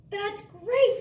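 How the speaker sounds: high, loud delighted cry; excited Tortuga. Acted. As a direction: burst out with joy. Tortuga's quote read aloud.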